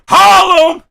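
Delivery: high, loud shout-out; hype shout. Real, not acted. Streamer shouting out New York boroughs, hype shout. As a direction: yell it out for the whole borough.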